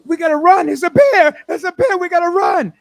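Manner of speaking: high, loud panicked shouting; panicked yell. Acted. Hunter shouting to his friend about the bear, panicked yell. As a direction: yell in terror that a bear is coming. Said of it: "He says, he says".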